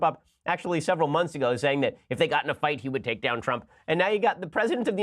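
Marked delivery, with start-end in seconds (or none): none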